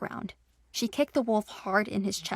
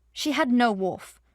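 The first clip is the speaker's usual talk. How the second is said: usual speaking voice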